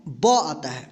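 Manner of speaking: ब said low and short; B sound. Shown as a demonstration. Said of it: "आवाज़ आता है". (ब को छोटा और धीमा बोलें)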